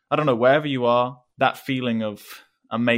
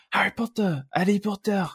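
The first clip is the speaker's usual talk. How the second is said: imitating a French kid's accent, high and excited; 9 semitones higher than usual. A French kid chasing the English magician, French accent. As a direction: call out the name like an eager French boy. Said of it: "in his French accent".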